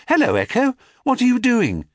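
high, bright greeting; cheery sister. A narrator's reading. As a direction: call out to your sister.

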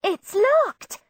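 high alarmed cry; cried out. A narrator's reading. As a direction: call out in shock. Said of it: "then cried".

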